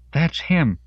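high, urgent accusing yell. A narrator's reading. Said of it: "Denise yelled".

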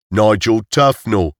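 slow, hammed-up English accent; a poor stab at an English accent; bad English accent. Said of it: "said Roger with a bad English accent".

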